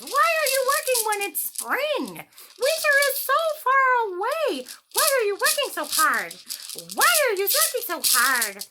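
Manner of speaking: high, loud carefree grasshopper voice; playful grasshopper. Acted. Grasshopper taunting the busy ants, high and loud. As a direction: tease the hard workers from up high. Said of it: "the grasshopper says".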